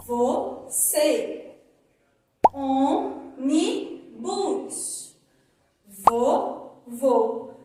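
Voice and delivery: você, ônibus, vou with a tight closed ê and ô; teacher modelling closed vowels; closed vowel demo. Shown as a demonstration. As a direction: close the vowels, stress them clearly. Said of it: "a pronúncia tá com som fechado".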